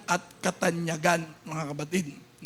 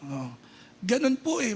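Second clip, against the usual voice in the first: ordinary talk, nothing marked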